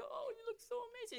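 put-on high, cooing baby-talk praise; doting dad. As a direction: dote on a little daughter. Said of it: "They dote on their little girls".

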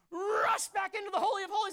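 high, very loud exhortation; urgent shout. Real, not acted. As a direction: yell it as a rousing charge. Urging sinners to run back.

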